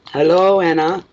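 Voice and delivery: high, loud, cheery hello; bright greeting. Real, not acted. Reader greeting her client on camera, high and loud. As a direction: sing out a welcome.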